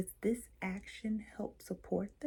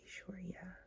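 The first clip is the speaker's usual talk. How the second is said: faint unsure yes; 14 dB quieter than usual. Shown as a demonstration. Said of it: "or a no might be".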